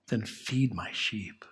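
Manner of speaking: hushed, slow whisper; a soft, unvoiced, slow telling. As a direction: breathe the words softly as Jesus. Quoting Jesus to Peter.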